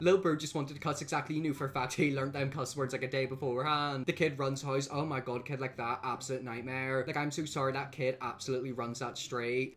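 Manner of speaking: ordinary delivery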